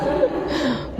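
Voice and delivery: airy high chuckle at his own joke; a quick breathy giggle; breathless laugh. Real, not acted. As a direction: let out a soft unvoiced laugh. Joking about gaul meaning genderuwo.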